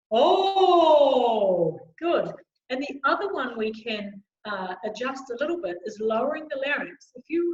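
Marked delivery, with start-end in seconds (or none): 0.1-1.8 loud, long "Oh" gliding down; lifted soft palate. Shown as a demonstration. Coach leading choir's palate exercise, lifted and open. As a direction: sustain a round, open call that falls. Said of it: "Raising the soft palate".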